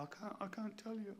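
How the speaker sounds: high, mock-tearful reply; imitated fake crying. Imitating the role-play partner hiding a car bill, pretend crying. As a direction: put on a small tearful voice. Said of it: "pretending to cry".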